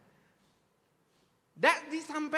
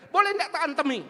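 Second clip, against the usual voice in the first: louder, higher furious plea to hit; the mother demanding permission; angry mother; 5 semitones higher, 8 dB louder, faster than usual. Retold or imitated. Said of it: "Wah, dia marah". (ibu yang marah besar meminta izin memukul)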